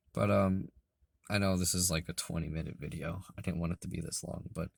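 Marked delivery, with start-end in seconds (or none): none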